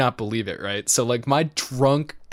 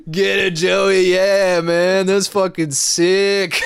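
slow, louder hype; mock cheering; 5 semitones higher, 5 dB louder, slower than usual. Friends on the floor cheering Joey, slow, louder. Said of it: "fucking, like".

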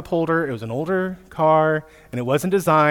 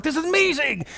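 put-on much higher, thrilled kid cry; excited; 10 semitones higher than usual. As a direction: squeal it like a delighted child. Kids thrilled at meeting cousins.

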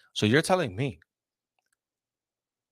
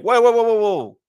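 high, loud mock alarm; 13 semitones higher, 10 dB louder than usual. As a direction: throw up a loud alarmed whoa. Mock reaction of his side.

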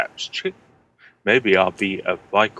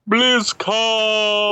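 excited; 13 semitones higher than usual. Real, not acted. Gushing about the trip.

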